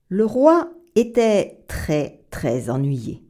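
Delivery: ordinary delivery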